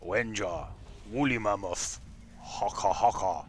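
slow caveman chant; drawn-out mock-primitive speech. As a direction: grunt it like a caveman. Mimics caveman language.

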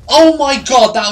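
a big high, loud "oh my god"; stunned shout. Real, not acted. Gamer stunned by a Cannavaro goal, loud shout. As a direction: blurt it out in disbelief.

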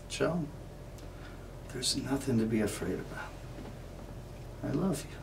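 soft, soothing sweetheart's words; tender reassurance. Acted. Irene reassuring Joe she loves him, softly tender. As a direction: hush it lovingly, with gentle pauses.

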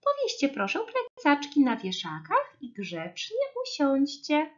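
high, chirpy kindergarten teacher; pani Łosia. A narrator's reading. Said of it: "zaszczepiotała ich nowa przedszkolanka".

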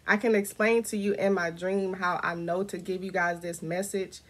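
normal talking voice

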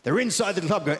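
normal talking voice

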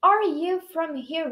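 slow, careful question with a rise; slow model reading. Shown as a demonstration. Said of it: "Treina aí do outro lado".

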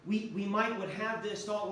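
usual speaking voice

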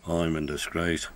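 low, gloomy mope; gloomy sigh. A narrator's reading. Gordon in disgrace among the engines, gloomily. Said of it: "sighed Gordon gloomily".

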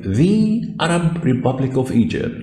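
slow, deliberate English phrase; teacher modelling slowly; slow demo reading. Shown as a demonstration. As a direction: spell it out slowly for students. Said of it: "جمهورية مصر العربية".

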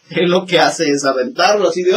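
usual speaking voice

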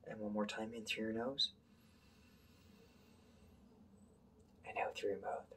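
soft, hushed breathing instructions with long pauses; guided breathing. Shown as a demonstration. Teacher leading the class in breathing, hushed and calm. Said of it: "leads a breathing exercise".

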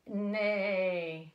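whinny like a horse; horse neigh imitation. Shown as a demonstration. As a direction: neigh for the little ones. Demonstrating horse sound.

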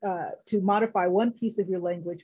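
ordinary talk, nothing marked

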